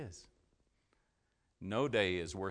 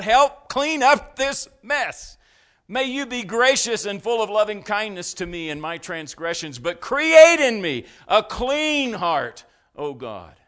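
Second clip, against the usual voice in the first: pleading prayer; 11 semitones higher, 16 dB louder than usual. Acted. Preacher voicing a desperate prayer.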